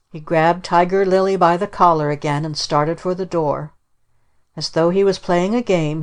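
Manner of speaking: ordinary delivery, nothing marked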